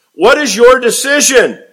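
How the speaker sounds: high, loud, outraged demand; demanding shout. Acted. High priest to the Sanhedrin, loud high outraged demand. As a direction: rouse the council to condemn him.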